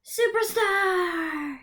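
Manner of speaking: superstar high and joyful; excited reveal. Shown as a demonstration. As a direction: cheer the whole word. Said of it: "put it together".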